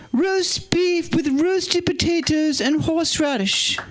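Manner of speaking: very high, slow posh English Queen impression; the Queen's voice. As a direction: play the Queen reading a menu, high and drawn out. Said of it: "do it in the Queen's voice".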